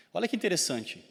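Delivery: usual speaking voice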